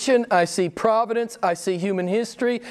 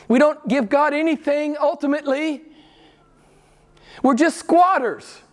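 fervent preaching; 9 semitones higher than usual. Acted. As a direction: proclaim it from the pulpit. Preacher rises to full force.

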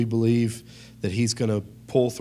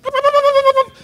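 high, loud yapping barks; dog barking; 12 dB louder, faster than usual. Retold or imitated. Pastor imitating his dog barking at a guest. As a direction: bark like a big scary dog. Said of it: "Bentley's like".

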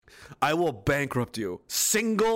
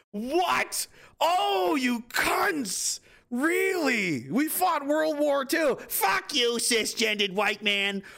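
put-on high, slow, shouting old-timer rant; mock outraged old man; 12 semitones higher, much slower than usual. War veteran grandpa yelling at ungrateful grandkids, high slow mock rage.

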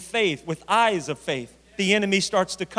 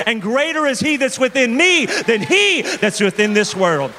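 loud, rising preacher's declarations; full-force preaching; 5 dB louder than usual. Acted. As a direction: build each claim louder to a climax.

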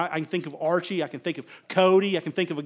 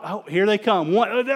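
ordinary talk, nothing marked

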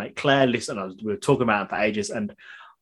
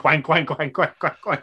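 duck voice; 3 semitones higher, slower than usual. Acted. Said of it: "You think of the wank".

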